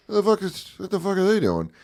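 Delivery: high-pitched retold reaction, baffled; mock-alarmed, voice jumping up; alarmed disbelief.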